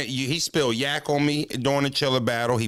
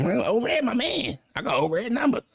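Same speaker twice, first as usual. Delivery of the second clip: higher-pitched mock dad voice; imitating his pops; 10 semitones higher than usual. Retelling his dad bragging about O-Red, higher mock voice. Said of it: "That's what my pop say".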